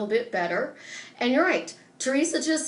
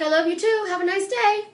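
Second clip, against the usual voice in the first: breezy retort; 7 semitones higher, 4 dB louder than usual. Retold or imitated. Breezy retold reply to texter.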